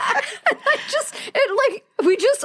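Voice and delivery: talking high through laughter; laughing. Real, not acted. Still cracking up over the theater memory, laughing while talking. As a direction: let the laugh spill into the words.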